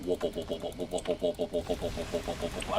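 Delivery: fast quiet warp repeated into an engine drone; mock warp chant. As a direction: drone warp warp like the designers at work. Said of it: "They just went".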